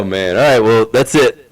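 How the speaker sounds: usual speaking voice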